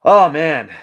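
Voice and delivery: ordinary talk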